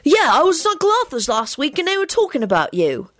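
put-on high, animated child voice; chatty boy. Alex tells Tim of his uncle's talk, chatty. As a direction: chatter brightly as a small boy.

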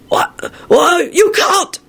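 put-on high, stammering old wizard shouting; stammering old warlock. A narrator's reading. Old warlock protests the minister's resignation, stammering high. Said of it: "an old warlock with tiny glasses stammered out".

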